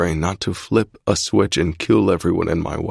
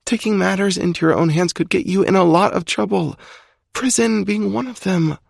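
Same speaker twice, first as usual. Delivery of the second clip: high female voice, pleading; girlfriend pleading; 13 semitones higher than usual. A narrator's reading. Alex begging Zai to stop, high female plea. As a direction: beg him to stop in her higher voice.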